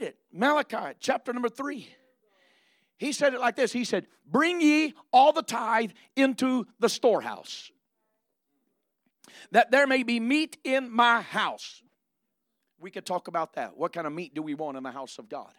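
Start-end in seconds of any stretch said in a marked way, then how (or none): 4.3-11.8 slow scripture reading. Acted. Preacher quoting Malachi to the church, slow and loud. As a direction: read it out slow and loud like holy writ.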